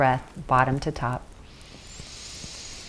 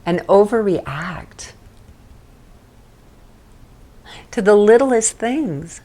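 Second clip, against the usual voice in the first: hammed-up drama queen, higher and louder; mock dramatic; 5 semitones higher, 5 dB louder, slower than usual. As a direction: overplay it for fun. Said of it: "It's actually kind of funny".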